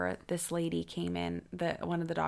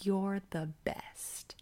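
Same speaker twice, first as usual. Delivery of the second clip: mock tender encouragement; 9 dB quieter than usual. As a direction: whisper it like a doting partner, tongue in cheek. Sarcastically imagines a supportive husband.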